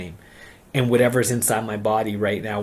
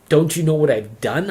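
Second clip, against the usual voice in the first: a raised, angry cry of self-loathing; retold shout; 5 semitones higher, 6 dB louder than usual. To the people praying over him, a loud angry outburst. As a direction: throw the question at them, loud.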